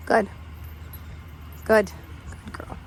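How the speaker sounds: usual speaking voice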